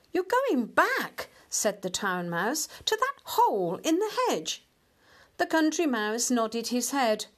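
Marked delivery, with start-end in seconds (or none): 0.1-1.3 high, slow disbelief; incredulous town mouse. A narrator's reading. 2.9-4.6 high-pitched disdainful disbelief; incredulous town mouse. A narrator's reading.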